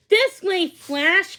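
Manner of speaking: high, loud call. A narrator's reading. Lizzie calling the dog this way, loud high call. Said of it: "called Lizzie".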